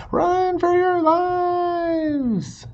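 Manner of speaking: high, loud, stretched-out panic yell; panicked cry. Acted. The worried forest friends panic as the beaver comes home, screaming. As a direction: scream it like terrified forest animals fleeing.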